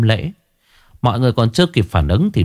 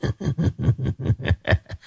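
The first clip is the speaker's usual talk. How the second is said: eerie cackle; 6 semitones higher, 7 dB quieter than usual. A narrator's reading. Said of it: "ngửa cổ cười sằng sặc".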